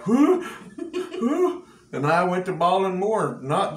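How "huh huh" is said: high startled double huh, half laughing; bewildered yelp at a voice from nowhere. Retold or imitated. Country preacher retelling hearing the Lord, startled huh. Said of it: "I was like".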